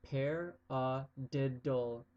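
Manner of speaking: "Paradiddle" drawn out slowly, four syllables apart; slow syllable split of "Paradiddle". Shown as a demonstration. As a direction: say "Paradiddle" slowly, pa-ra-did-dle. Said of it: "you break it down in four syllables".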